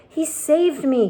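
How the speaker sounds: slow, high breathless words; just pulled from the sea and gasping. Acted. Rescued centipede thanking James, out of breath. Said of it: "gasped the centipede".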